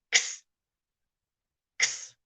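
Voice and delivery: X said as a breathy k s with no voice; teacher models the x sound; voiceless x. Shown as a demonstration. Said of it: "there's no voice to it".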